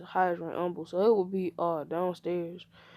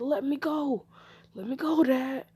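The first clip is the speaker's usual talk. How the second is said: high-pitched struggling protest; 6 semitones higher than usual. Acted. Boy held by his father, high protest. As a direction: demand release, voice pushed up high.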